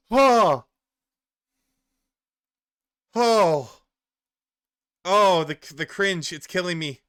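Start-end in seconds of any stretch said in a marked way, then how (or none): 0.1-5.6 drawn-out agonized oh; loud pained groan. Real, not acted. Streamer groans aloud at the cringe clip, loud and pained. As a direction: groan like it physically hurts.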